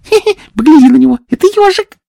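high fast giggly voice; giggling. Acted. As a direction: sly giggle through the words. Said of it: "хихикали".

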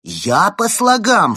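high, loud, drawn-out outrage; indignant. A narrator's reading. Penguin accused of reading by syllables, indignant. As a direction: stretch it out in offended disbelief. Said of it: "в свою очередь возмутился Евгений".

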